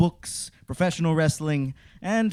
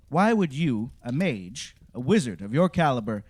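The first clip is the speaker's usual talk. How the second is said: ordinary delivery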